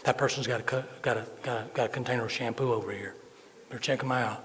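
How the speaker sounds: quietly tip off the officers; hushed, understated report; sniffer-dog wife. Acted. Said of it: "She could go".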